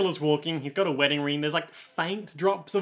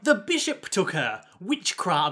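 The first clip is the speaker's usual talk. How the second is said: high-pitched, drawn-out Cockney; old woman sliding into Cockney orphan; 3 semitones higher, slower than usual. Acted. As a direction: play a Cockney street orphan. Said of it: "being more of a Cockney orphan".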